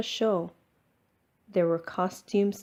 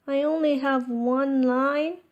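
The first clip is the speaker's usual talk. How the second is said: disappointed question; 6 semitones higher, 3 dB louder than usual. Acted. Max dismayed at small role.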